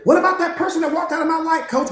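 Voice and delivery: hurt student. Acted. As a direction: plead your hurt to the coach.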